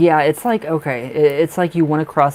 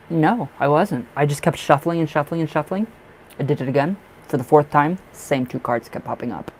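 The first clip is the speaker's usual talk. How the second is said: normal talking voice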